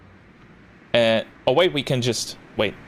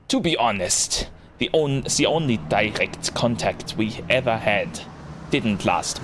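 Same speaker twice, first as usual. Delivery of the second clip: put-on German accent. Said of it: "Like, really lean into it".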